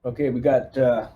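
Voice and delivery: ordinary talk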